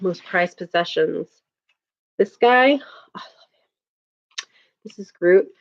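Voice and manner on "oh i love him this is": much softer, whispered, loving aside; fond whisper. Real, not acted. Whispering her love for the Groot toy on stream. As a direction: breathe it out over your treasured toy.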